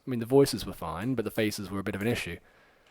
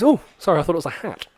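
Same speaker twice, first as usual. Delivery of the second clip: mock startled apology; 6 semitones higher, 9 dB louder, faster than usual. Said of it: "He goes".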